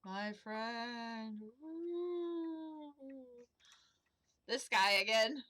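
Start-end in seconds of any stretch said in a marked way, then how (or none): 1.6-2.9 ghostly woo. Acted. Ghost speaks in hallucination.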